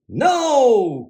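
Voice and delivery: cried. A narrator's reading. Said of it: "cried the witch".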